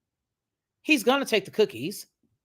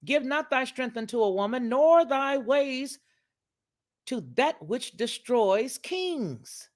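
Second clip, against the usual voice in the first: preaching; 6 semitones higher, slower than usual. Acted. Preaching the verse slowly.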